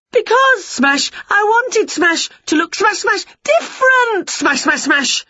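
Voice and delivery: very high, loud cry broken by crash noises; falling Winnie. A narrator's reading. Winnie answering as she falls among breaking plates, frantic. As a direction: punch each smash between the wailed words.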